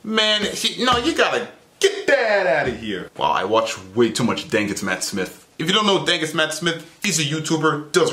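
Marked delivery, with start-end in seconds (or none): none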